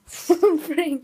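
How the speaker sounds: loud. Real, not acted. Repeats loudly.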